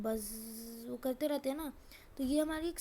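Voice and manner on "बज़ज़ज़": drawn-out buzzing z; imitated bee buzz. Shown as a demonstration. Teacher demonstrating onomatopoeia to students, imitated long bee buzz. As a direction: hold the z like a bee. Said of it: "बीज हैं वो बज़ करते हैं".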